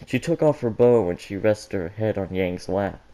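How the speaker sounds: plain reading voice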